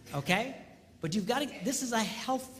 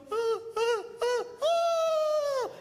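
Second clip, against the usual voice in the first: very high, loud playful ah ah; high teasing ah ah; 6 dB louder, much slower than usual. Retold or imitated. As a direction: tease a toddler with high sing-song sounds. Teasing toddler in hide and seek.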